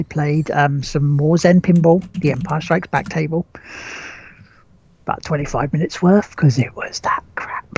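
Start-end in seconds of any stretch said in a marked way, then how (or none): 6.3-7.6 hushed aside, no voice; whispering. Real, not acted. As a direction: breathe it out as a secret. Whispered aside about bad game.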